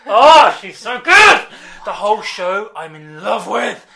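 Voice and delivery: gushing. Real, not acted. Gushing over Wanda.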